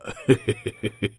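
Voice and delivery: high, breathy laughing fit; mocking laugh. Ragged man laughing at the family's plans. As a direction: let the laugh run out of control. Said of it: "خنده دوباره آغاز شد".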